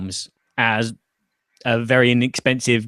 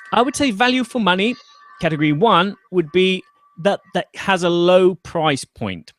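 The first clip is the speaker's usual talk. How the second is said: lightly raised, sweet reading voice; very nice voice; 7 semitones higher than usual. Acted. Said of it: "reading it in a very nice voice".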